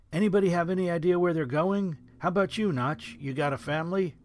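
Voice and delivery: put-on eager young soldier asking around; a lightly voiced excitable buddy; Rich's voice. A narrator's reading.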